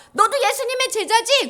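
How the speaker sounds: high, loud accusation; accusing woman. Acted. Woman challenging Peter about Jesus, loud accusing. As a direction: call him out loudly.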